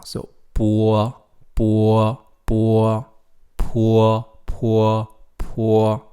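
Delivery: "bo" en "po" luider, met een u-glijder ervoor; "bo" en "po" herhaald, u eerst dan o; Pinyin bo po. Shown as a demonstration. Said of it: "You have to say a u first".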